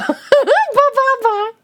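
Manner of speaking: high, drawn-out syllables through laughter; laughing mimicry. Reader mocking failed attempt at banana, high and laughing. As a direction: bleat it slowly and high while laughing.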